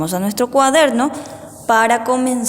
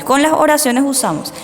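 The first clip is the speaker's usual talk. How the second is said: ordinary talk, nothing marked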